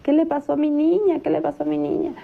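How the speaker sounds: re-enacting a mother's panicked cry; retold panicked cry. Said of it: "empieza a gritar y dice".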